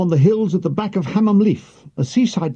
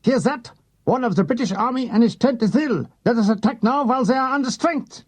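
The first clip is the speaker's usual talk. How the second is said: a hint of a ranting Hitler, pitched higher; lightly voiced German dictator; mock Hitler plotting an attack, lightly voiced; 6 semitones higher than usual.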